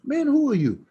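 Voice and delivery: retold amazement at a stranger's faith; astonished question. Stunned father questions the speaker.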